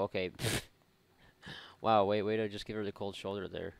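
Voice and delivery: ordinary talk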